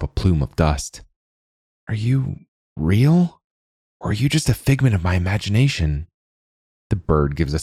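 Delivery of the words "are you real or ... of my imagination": amazed question. A narrator's reading. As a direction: gasp it out in disbelief. Girl meets talking bird.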